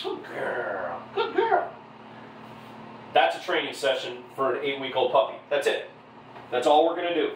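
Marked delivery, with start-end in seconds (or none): 0.0-1.7 schoolgirl voice. Real, not acted. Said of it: "schoolgirl voice".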